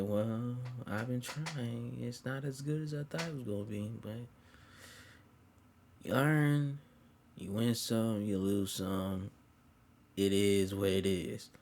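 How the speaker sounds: soft, slow, resigned reply; quiet resignation. Retold or imitated. Her admitting it is not so good, quietly resigned. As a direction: concede it softly, drawing out the words.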